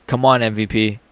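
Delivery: ordinary talk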